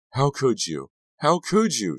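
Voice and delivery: high, loud outraged cry; screaming accusation. A narrator's reading. Girlfriend screaming into Kerry's face, outraged accusation. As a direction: scream it at him, rising on the repeat. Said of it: "she screamed into Terry's face".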